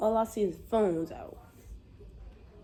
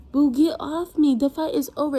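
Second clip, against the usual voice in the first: loud, raised-pitch protest; exasperated demand; 4 semitones higher, 8 dB louder than usual. Retold or imitated. Telling the girl pinning her to get off, loud exasperation. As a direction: push it out loud, fed up. Acted quarrel, pinned in fight.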